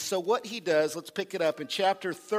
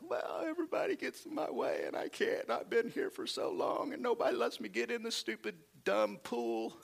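higher, half-whispered whine; whiny paralyzed man; 6 semitones higher, 6 dB quieter than usual. Pretend. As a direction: whimper like a self-pitying man.

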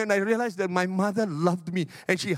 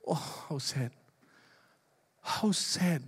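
sorrowful; 6 dB quieter, slower than usual. Acted. Preacher lamenting nobody answers.

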